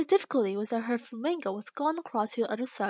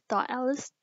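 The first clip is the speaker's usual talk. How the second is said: ordinary delivery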